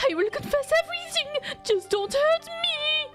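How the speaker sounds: high, frantic pleading voice; panicked high voice. Acted. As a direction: beg for mercy in a high panic. Panicked suspect begging.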